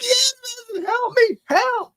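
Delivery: hammed-up panicked wail, pleading for help; imagining a missing kid, mock-crying for help; mock crying plea. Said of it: "I'd be crying".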